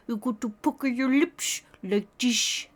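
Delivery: lightly voiced Sebastian, lips puckered; a hint of the calypso crab. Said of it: "That's exactly like Sebastian".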